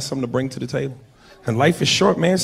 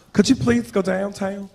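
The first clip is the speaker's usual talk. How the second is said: slow, higher mock female voice; cutesy, drawn-out request; cute woman; 5 semitones higher, slower than usual. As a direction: ask sweetly like a coy woman. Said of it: "all kind of cute little sayings".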